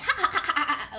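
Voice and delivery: high, loud mock cackle; eerie high ha-ha-ha; imitated cackling. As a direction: cackle like something unseen in the hall. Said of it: "I heard a cackling".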